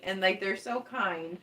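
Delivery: ordinary talk, nothing marked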